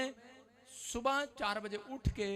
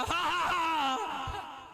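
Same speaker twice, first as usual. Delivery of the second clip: laughing; 7 semitones higher, much slower than usual. Retold or imitated. Retelling laughing fit.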